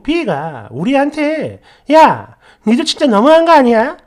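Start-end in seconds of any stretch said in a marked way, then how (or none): 1.9-4.0 put-on louder, higher, indignant scolding of old friends; a friend angrily demands her wedding gift; angry complaint. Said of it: "이러면서 막 화를 내는 거예요".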